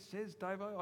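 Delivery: usual speaking voice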